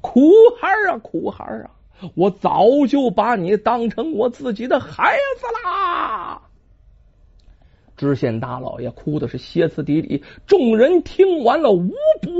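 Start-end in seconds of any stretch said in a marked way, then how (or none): 0.0-6.4 put-on sobbing cry for the dead boy; wailing. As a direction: wail with grief over the child. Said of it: "一边哭一边说". (知县放声痛哭)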